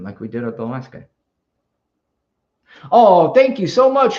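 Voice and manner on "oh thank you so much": much louder, bright thanks; delighted thanks. Real, not acted. Host thanking a viewer for a gift, delighted. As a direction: gush with surprise.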